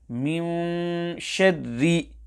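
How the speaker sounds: "مِنْ" hummed with lips shut, so the noon turns into a meem; incorrect lips-closed ikhfa. Shown as a demonstration. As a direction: demonstrate the mistake: close the lips on the noon of "مِنْ" before "شَرِّ". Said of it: "sound will be like this".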